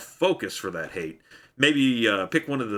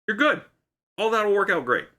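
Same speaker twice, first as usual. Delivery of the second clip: much higher, cheery ending; 12 semitones higher than usual. Retold or imitated. Jackman persona, cheerful sign-off.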